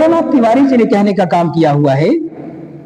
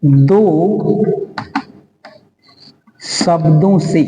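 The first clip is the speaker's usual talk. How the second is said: slow, lower-pitched word-by-word dictation; 3 semitones lower, slower than usual. Real, not acted. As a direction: spell it out slowly for note-takers. (धीरे-धीरे इमला बोलते हुए)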